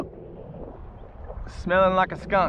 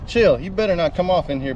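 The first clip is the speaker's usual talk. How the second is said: normal talking voice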